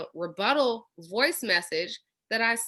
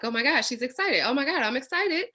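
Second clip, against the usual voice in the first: rising from chatty to squealing high and loud; building excitement; 11 semitones higher, 6 dB louder than usual. Retold or imitated. Prospect hearing a voice clip, squealing with building excitement. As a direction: talk yourself into buying, getting giddier.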